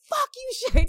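high, loud mock yell; mock shout. Mom hopping up at the bottom, yelling high. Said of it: "I hop up".

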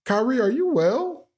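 high, amused mock concern. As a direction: ask it with laughing disbelief.

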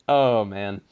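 ordinary delivery

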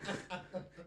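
laughing question. Real, not acted. Laughing at herself.

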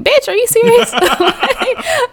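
can't believe what he's hearing; shocked disbelief. Retold or imitated. Elroy shocked that Maude wants the attention, high disbelieving yelp. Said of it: "Elroy was surprised".

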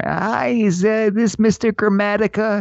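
a hint of a chirpy caller mangling the name; prank caller. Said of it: "give 'em a call from a block number".

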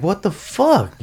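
high, loud, incredulous retelling; worked-up disbelief, high and loud; retold bewilderment. Acting out his alarmed thoughts.

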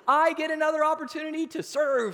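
high, loud whoop of fake joy; hammed-up, high-pitched enthusiasm; mock elation. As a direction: cheer as if thrilled by a chore. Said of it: "jump up and go".

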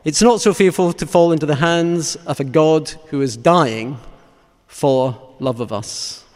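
measured, fervent sermon close; solemn preaching. Real, not acted. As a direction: preach it weightily, phrase by phrase, letting each one fall. Preaches a closing meditation on Christ's death.

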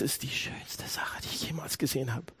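whispered in wonder at the cross. Shown as a demonstration. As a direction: breathe it in quiet awe. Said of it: "Das ist die schönste Sache".

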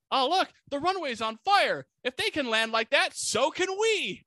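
high-pitched mock pilot on the radio; excited radio chatter. Pilot spots the burning runway over radio, hammed-up excited chatter. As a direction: play an elated pilot, stretching the last words high. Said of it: "there's a little bit of radio chatter too".

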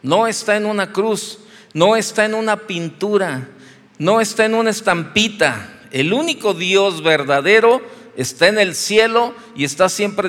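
loud, emphatic repeated "no" at each phrase; fervent preaching. Acted. Pastor preaching to his congregation, forceful and emphatic. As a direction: hammer each line home from the pulpit.

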